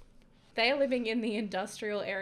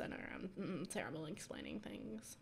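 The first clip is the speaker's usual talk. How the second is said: whispering; 2 semitones lower, 11 dB quieter than usual. Real, not acted. Self-deprecating aside.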